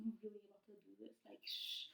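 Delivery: hushed, fast secretive aside; imitated whispering. Host imitating a man whispering to Kirk, hushed. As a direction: whisper it so nobody overhears. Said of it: "then he was whispering to".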